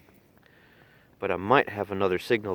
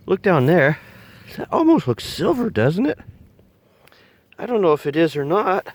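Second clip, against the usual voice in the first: excited; 9 semitones higher, 8 dB louder than usual. Real, not acted. Excited spotting something in hole.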